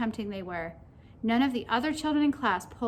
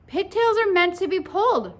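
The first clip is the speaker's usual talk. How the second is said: raised, loud emphatic declaration; higher, forceful schoolboy pronouncement; Paul's concluding declaration; 8 semitones higher, 6 dB louder than usual. A narrator's reading. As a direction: proclaim it as a final verdict. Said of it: "Paul concluded".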